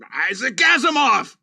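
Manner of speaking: yelled curse, loud and high; waking-up outburst; angry shout. Retold or imitated. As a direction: shout it like a swear word. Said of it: "that's how I woke up".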